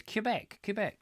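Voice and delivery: "Quebec" repeated with a "cue" start; Quebec as KYOO-bek. Shown as a demonstration. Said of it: "that's how I've always pronounced it".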